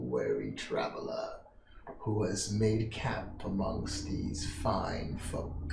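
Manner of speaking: put-on low, slow, near-whispered old man; weary old traveler. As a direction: let the words sink into a weary hush.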